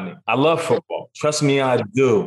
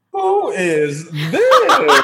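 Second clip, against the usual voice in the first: high, slow, drawn-out surprised question; surprised who is this; 11 semitones higher, 5 dB louder, much slower than usual. Retold or imitated. A stranger coming round the corner, asking high and slow, half laughing. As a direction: stretch it out like you can't believe who just walked in. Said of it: "she was walking around the corner like".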